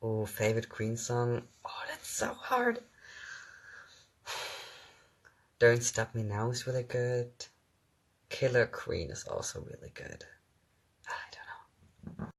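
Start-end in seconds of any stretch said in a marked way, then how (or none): none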